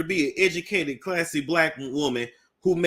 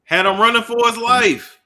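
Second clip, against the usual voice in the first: loud outburst; 8 semitones higher, 8 dB louder than usual. Real, not acted. Mocking reaction to chase video.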